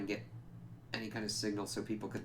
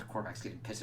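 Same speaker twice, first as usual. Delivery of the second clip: ordinary talk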